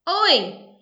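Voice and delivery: put-on a boy calling his gang from the driveway, shouting. A narrator's reading. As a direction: holler across the street to your mates. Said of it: "he shouted".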